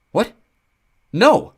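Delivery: high loud dismayed cry; alarmed. Acted. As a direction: panic at the news. Nico alarmed.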